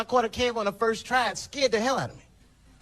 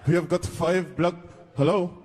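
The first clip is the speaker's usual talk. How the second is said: terrorist on phone. Retold or imitated.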